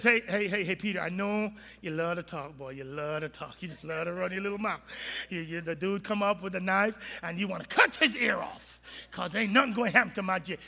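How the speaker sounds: a hint of a patient master calling out a boaster; gently scolding Jesus. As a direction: chide Peter like a knowing older friend. Jesus scolds Peter, low voice.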